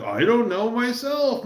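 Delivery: high, wailing crazy pilot bit; crazy Murdoch voice. Retold or imitated. Fan doing the crazy pilot squealing his line. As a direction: squeal it like a madman cracking up.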